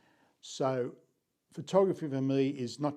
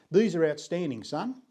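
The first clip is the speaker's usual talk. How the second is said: higher, imitated broad English accent; 4 semitones higher, 5 dB louder than usual. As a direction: praise a pupil like an English headmaster, in his accent. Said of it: "in that broad English accent".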